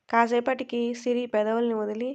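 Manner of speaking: ordinary delivery, nothing marked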